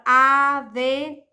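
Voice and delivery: "avental" slowly split, a-ven-tal, ending strong; syllable by syllable. Shown as a demonstration. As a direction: sound out "avental" in separate syllables. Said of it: "a última sílaba é a sílaba tônica". ("avental" sílaba por sílaba, com "tal" forte)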